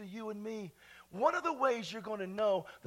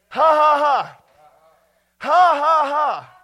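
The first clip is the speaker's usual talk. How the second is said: deliberate, shouted "ha, ha, ha"; loud triumphant laugh; 7 semitones higher, 19 dB louder, slower than usual. Real, not acted. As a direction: belt out a victory laugh. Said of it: "I have a lot to laugh about".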